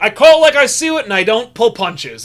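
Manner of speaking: loud, high opening, slowing on the second half; brash straight-talker. Retold or imitated. Mocking a classmate's Howard Stern approach, brash and loud. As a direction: come in hard and blunt, then ease down. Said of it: "took the Howard Stern approach".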